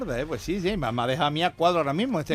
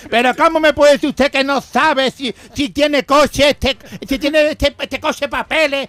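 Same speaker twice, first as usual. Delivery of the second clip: loud, higher outraged cop, dragging out the questions; more worked-up policeman; 8 semitones higher, 8 dB louder than usual. Acted. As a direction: hammer the driver with disbelief. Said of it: "más revelado todavía".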